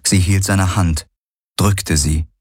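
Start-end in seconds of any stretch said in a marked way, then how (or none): none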